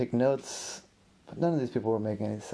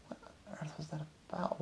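very soft, higher-pitched wondering; hushed, baffled question to oneself; puzzled self-talk; 4 semitones higher, 13 dB quieter than usual. Acted. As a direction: think aloud under your breath. Character puzzles alone to himself.

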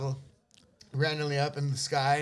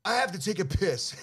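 put-on drunk whine; 6 semitones higher than usual. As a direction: slur it out like a drunk. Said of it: "And I'm like".

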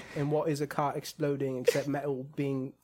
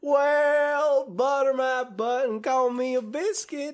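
hammed-up Southern cowboy; 9 semitones higher, 6 dB louder than usual. Podcaster clowning as a folksy cowboy, hammed-up Southern accent. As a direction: whoop it up like a cartoon Southern cowpoke.

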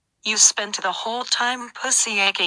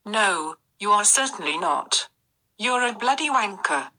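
put-on British accent. British voice insult.

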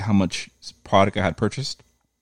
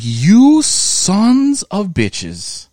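loud, high, drawn-out outburst; furious curse; 13 semitones higher, 7 dB louder, much slower than usual. Retold or imitated. Venting at FedEx in a loud, high, drawn-out curse. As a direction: stretch every word in exasperated rage. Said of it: "I was like".

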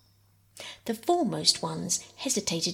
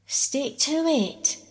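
slow, high, panted encouragement; panting; 7 semitones higher, 4 dB louder, much slower than usual. Acted. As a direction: push the words out between breaths. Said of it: "panted Peter".

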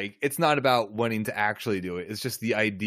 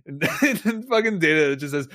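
usual speaking voice

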